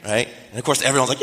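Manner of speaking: normal talking voice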